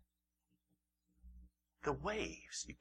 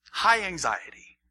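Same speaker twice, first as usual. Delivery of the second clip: slow, loud and higher, mock panic; mock alarm; 6 semitones higher, 8 dB louder, slower than usual. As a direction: play up the alarm. Preacher dramatizing disciples' fear.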